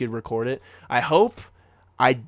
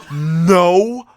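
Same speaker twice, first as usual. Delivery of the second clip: loud, slow, drawn-out disbelief; shocked yell; 4 semitones higher, 10 dB louder, much slower than usual. Retold or imitated. Telling friends how he freaked out at the gun, yelling. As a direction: bellow it in stunned disbelief. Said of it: "I start going crazy".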